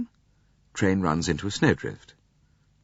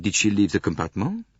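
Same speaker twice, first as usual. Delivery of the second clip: ordinary delivery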